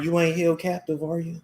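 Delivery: usual speaking voice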